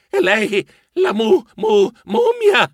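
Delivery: high, stuttering fright; terrified stammer. A narrator's reading. Narrator recognises the mummy's stench, stammering. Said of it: "così balbettai".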